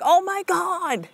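high, slow, drawn-out amazement; amazed. Retold or imitated. Mom marvels to her son at the bell, slow high awe. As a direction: stretch it out in wonder.

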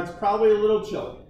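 ordinary delivery

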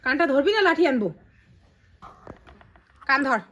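real motherly telling-off; stern scolding. Real, not acted. Mother scolds child, threatens stick.

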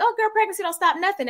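high, fast, breezy brush-off; breezy dismissive. Retold or imitated. Mimicking her old carefree self to her friend, breezy and fast. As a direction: toss it off as if pregnancy is nothing.

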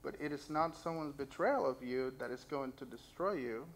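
ordinary talk, nothing marked

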